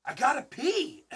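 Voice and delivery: hammed-up pee excuse; husband whining to wife that he needs to pee; mock urgency. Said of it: "I said".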